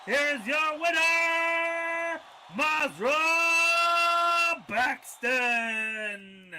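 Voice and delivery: slow drawn-out winner call; ring announcer. Acted. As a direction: announce the champion, slowly.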